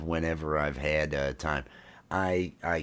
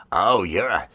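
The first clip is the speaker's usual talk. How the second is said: mock Australian accent; 3 semitones higher, 7 dB louder than usual. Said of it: "but I, the Aussie".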